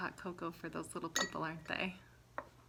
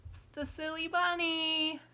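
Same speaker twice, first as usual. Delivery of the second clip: silly voice; 9 semitones higher than usual. Acted. Introducing the silly bunny prop.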